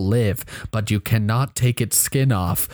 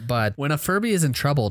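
normal talking voice

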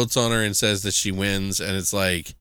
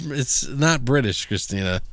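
ordinary talk

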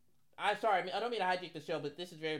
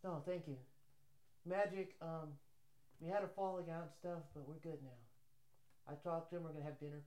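soft, hushed impression, trailing lower; Rob Pelinka; 5 semitones lower, 12 dB quieter than usual. Agent talking about the falling out with Magic, hushed impression. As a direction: keep up the quiet, halting agent voice. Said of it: "He talks like this".

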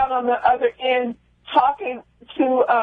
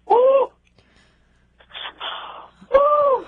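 emotional high woo; 13 semitones higher than usual. Real, not acted. [name] overwhelmed after retelling her brother's cell, emotional woo. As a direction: let the feeling burst out high. Overcome by emotion, host apologizes.

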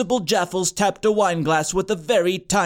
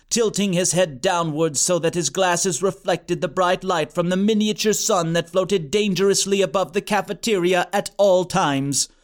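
ordinary delivery

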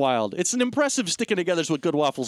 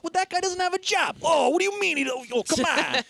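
high-pitched mock indignation; exasperated protest; 13 semitones higher than usual. Retelling a character's objection, exasperated. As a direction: object in a high, incredulous whine.

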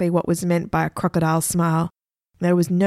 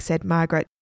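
ordinary delivery, nothing marked

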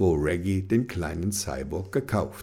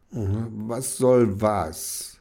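very slow drowsy mumble; sleepy mumble; much slower than usual. Acted. Kenny answers Reggie drowsily, mumbling slowly. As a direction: mumble it half asleep. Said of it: "Kenny nuschelte schlaftrunken zurück".

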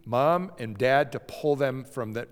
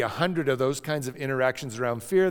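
ordinary delivery

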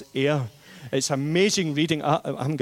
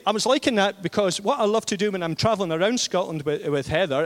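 ordinary delivery

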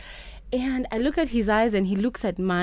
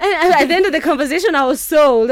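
bursting out high and loud, thrilled; excited; 8 semitones higher, 9 dB louder, faster than usual. Real, not acted. To the radio host, recalling being won over, loud and thrilled.

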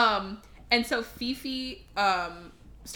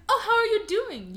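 high, fake-friendly greeting; mock-sweet Fifi; 10 semitones higher, 3 dB louder than usual. Fifi greeting her rival Belle, falsely sweet and high. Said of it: "Fifi's like".

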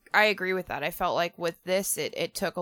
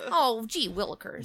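put-on wholesome 1950s kid; 4 semitones higher than usual. Mocking polite kid talk.

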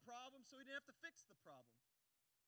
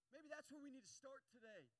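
ordinary talk

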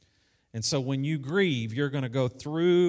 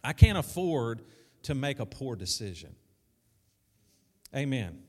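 ordinary talk, nothing marked

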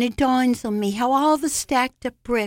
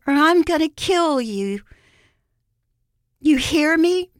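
higher, raised threat; threatening call; 5 semitones higher, 4 dB louder than usual. A narrator's reading. Anthony threatening to kill her, calling it out threateningly. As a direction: drive the threat home, short of shouting. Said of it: "he calls threateningly".

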